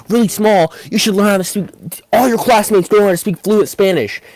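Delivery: high, loud, incredulous mock-teacher rant; snapping. Teacher outraged at being told she's overreacting, retold snapping. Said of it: "then she just snaps".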